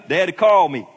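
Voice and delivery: loud, high, punched declaration; emphatic rule. Real, not acted. Preacher hammers home his rule.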